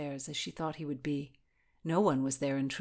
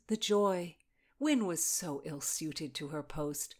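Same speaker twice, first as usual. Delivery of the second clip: ordinary delivery, nothing marked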